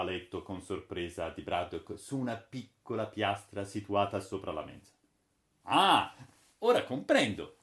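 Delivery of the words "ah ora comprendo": exclaiming. Acted. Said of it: "esclamò Toby".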